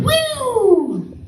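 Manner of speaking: high falsetto siren wail; imitated siren. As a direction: mimic a siren with one long high hoot.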